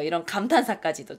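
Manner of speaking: ordinary talk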